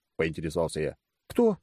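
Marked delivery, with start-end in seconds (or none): 1.3-1.6 higher surprised question in Gadya's voice; a short, high-pitched "who?" of surprise; удивление. A narrator's reading. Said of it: "удивилась Гадя". (удивлённо, высоким голосом)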